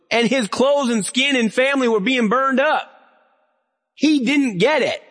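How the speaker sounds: high, ringing pulpit voice; preaching at full force. Acted. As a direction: preach it hard to the congregation.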